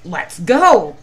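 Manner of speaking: loud crowing call; crowing rooster. Acted. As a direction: crow it like a rooster. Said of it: "crowed Cocky Locky".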